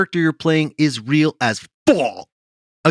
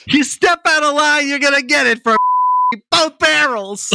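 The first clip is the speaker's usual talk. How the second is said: hammed-up tough talk; 9 semitones higher than usual. Hyping the tough star.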